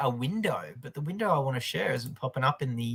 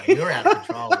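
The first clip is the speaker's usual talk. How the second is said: high laughing protest; 5 dB louder than usual. Real, not acted. Teased by co-host about his shirt, laughing high protest. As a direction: laugh through it, pitched up.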